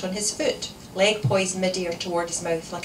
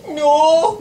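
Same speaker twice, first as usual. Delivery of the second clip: high, loud, drawn-out protest; child's wail; 9 semitones higher, 12 dB louder than usual. A narrator's reading. Daughter begging not to cancel, loud wail. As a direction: wail like a thwarted little girl. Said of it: "wailed Ailey".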